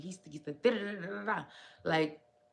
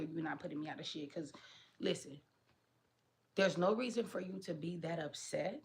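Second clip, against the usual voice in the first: ordinary delivery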